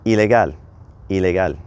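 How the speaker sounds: ilegal said the Spanish way, ee-leh-GAHL, single L; Spanish pronunciation. Shown as a demonstration. Teacher models ilegal in Spanish for learners. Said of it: "drops one of the L's when we convert it into Spanish".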